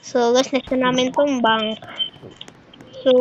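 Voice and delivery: ordinary talk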